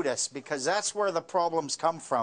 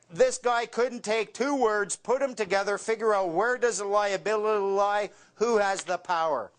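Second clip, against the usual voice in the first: ordinary talk, nothing marked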